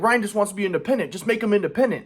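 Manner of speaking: lightly voiced grandma advising; imitating grandma. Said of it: "my grandma's like".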